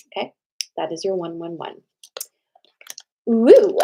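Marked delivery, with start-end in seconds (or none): 3.3-3.8 loud, high whoop of joy; excited cheer. Real, not acted. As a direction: let out a big happy woo.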